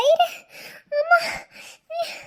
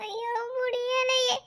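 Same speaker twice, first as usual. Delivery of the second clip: slow, much softer, sobbing; mother crying out weakly in labour; crying in pain; 4 semitones lower, 9 dB quieter, slower than usual. Acted. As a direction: let the pain break the words.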